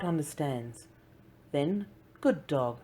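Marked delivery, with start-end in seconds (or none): 2.2-2.8 slow, glowing praise, lightly voiced boy; a boy gently proud of his puppy; very pleased. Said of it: "very pleased".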